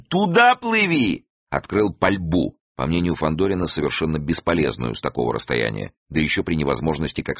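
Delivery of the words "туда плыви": high, drawn-out shouted order; yelling. A narrator's reading. Гасым directs the helmsman toward the enemy, yelling. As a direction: yell the order high and slow. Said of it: "завопил он".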